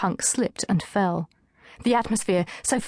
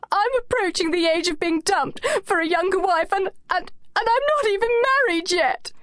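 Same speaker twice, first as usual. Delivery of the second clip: much higher, breaking sobbing wail; blubbering; 11 semitones higher than usual. A narrator's reading. Anushka crying to her friends at the club, blubbering wail. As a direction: wail it through sobs, rising. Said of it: "Her blubbering increased in intensity".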